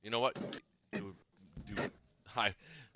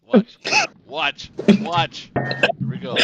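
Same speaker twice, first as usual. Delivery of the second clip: excited; 10 semitones higher, 13 dB louder, much slower than usual. Real, not acted. Dice roll hype.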